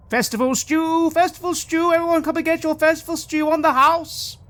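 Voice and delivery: high, loud crier call. Acted. Innkeeper calling everyone down for free stew, loud and high. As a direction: hawk the stew to the whole inn.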